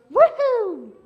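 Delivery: high, loud whooping shout; bouncer's whoop. Retold or imitated. Bouncer tossing a rowdy guy out, loud whoop. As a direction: heave him out the door with a big whoop. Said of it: "God's perfect love takes fear and goes".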